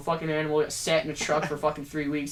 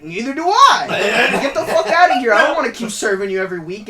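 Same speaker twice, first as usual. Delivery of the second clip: louder, higher, laughing comeback to the customer; mock angry retort; 7 semitones higher, 12 dB louder than usual. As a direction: bark back at the complainer, half laughing. Snapping back at customer.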